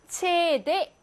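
CD spelled in German, "최대"; slow, separate German letter names in "최대". Shown as a demonstration. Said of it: "그냥 읽으면 돼요".

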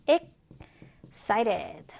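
EXCITED drawn out, paused after "ex"; stretched-out teaching model of "excited"; slow syllable-by-syllable. Shown as a demonstration. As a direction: say "excited" very slowly, split after the first syllable. Teacher models word broken into syllables.

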